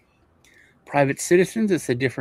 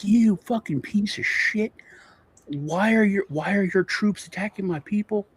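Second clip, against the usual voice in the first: retold angry rant; 6 semitones higher than usual. Cartel boss cursing out the police chief on the phone, imitated anger. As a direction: retell a pissed-off tirade without shouting. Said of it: "he's going off, right? Pissed. Tony Montana, angry".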